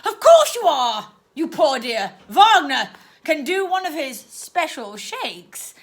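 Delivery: aunt offering owl shakes to her niece; with a flourish. Acted. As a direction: present it like a treat. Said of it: "said the woman with a flourish".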